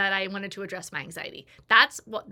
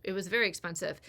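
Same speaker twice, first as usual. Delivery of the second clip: ordinary talk, nothing marked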